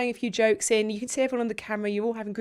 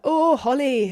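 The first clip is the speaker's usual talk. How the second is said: put-on Yorkshire old man, a touch higher and louder; northern accent; 4 semitones higher, 6 dB louder, slower than usual. Mimicking the Yorkshire course leader greeting Holly, northern accent. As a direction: greet her warmly as old Andrew from Yorkshire. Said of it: "I'm gonna try and do a northern accent".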